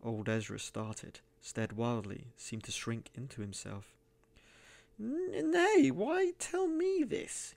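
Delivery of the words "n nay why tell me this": drawn-out, quavering high plea; high, slow, frightened old miser. A narrator's reading. Cornered miser pleading with Kane, high and slow. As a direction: stretch it out high and afraid. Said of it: "he muttered".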